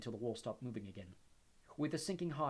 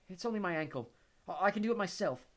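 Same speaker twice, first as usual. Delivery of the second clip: higher, clipped-off insistence; impatient; 8 semitones higher, 6 dB louder than usual. A narrator's reading. Ginny impatiently refuses Luna's help. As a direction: brush off the help. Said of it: "said Ginny impatiently".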